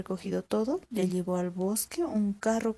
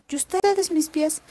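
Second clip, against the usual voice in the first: voz de zorra aguda y viva, más alta; zorra astuta; 8 semitones higher, 7 dB louder, slower than usual. Acted. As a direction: una zorra lista que pregunta con curiosidad. (la zorra pregunta al campesino asustado, voz aguda y curiosa)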